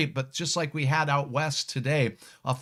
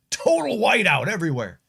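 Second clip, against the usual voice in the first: mock doom; 4 semitones higher, 5 dB louder than usual. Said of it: "No, I'm kidding".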